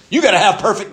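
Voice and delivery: loud, high mock demand, laughing; a joking, over-the-top demanding voice then dropped; mock stern Jesus. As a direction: play a fake harsh Jesus for the joke. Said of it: "And Jesus said".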